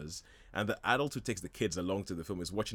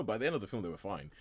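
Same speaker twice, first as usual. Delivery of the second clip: ordinary delivery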